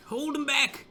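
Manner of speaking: desperate high rallying cry. Real, not acted. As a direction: beg them to hold, voice climbing high. Urging troops on in battle.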